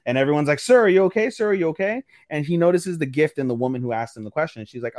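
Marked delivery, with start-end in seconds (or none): 0.6-2.0 high-pitched acted concern for someone who fell; concerned onlookers. Bystanders check on boy who tripped, high concerned voices. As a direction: call out as worried bystanders, pitched up. Voicing alarmed onlookers after a fall.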